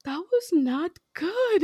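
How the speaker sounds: high, slow, teary little voice; imitating tearful daughter. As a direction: play the upset child, slow and high. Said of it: "she's crying and she's like".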